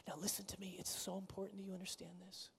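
whispered, urgent plea to listen; intimate whisper. Real, not acted. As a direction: lean in and whisper the point. Urging the congregation closely.

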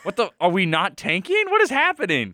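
high, loud incredulous outburst; can't believe what's happening; excited disbelief. Real, not acted. Team unexpectedly winning, disbelief.

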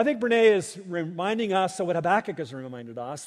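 ordinary talk, nothing marked

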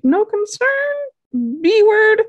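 very high-pitched, incredulous squeal; mock outrage. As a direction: imitate indignant disbelief, shooting way up high. Acts Hot's outraged reply.

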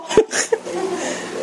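breathy burst of laughter; real laugh. Real, not acted. Pastor laughs at the accusation.

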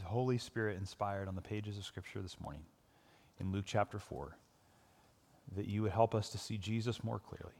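hushed, low prayer voice. Acted. Pastor prays over the congregation, hushed and low. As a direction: pray softly to God for the listeners. Opening prayer before the sermon.

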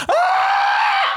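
long high sustained coaster scream; high held "Ah!" yell. Shown as a demonstration. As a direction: hold a ride scream out loud. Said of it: "if I don't scream like that".

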